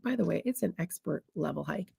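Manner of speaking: tossed off quietly, mid-list; a quiet throwaway aside; slipped in casually. Retold or imitated. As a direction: drop it in like it's nothing. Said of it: "slips in the middle of the list".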